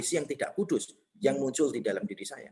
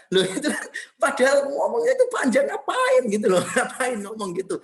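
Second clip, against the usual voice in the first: laughing outburst; 7 semitones higher, 6 dB louder than usual. Real, not acted. Laughing at his own rudeness.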